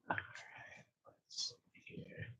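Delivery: breathy whisper to self; whispered aside. Real, not acted. Streamer busy cutting, whispering to himself. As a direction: whisper it under your breath. Murmured while crafting.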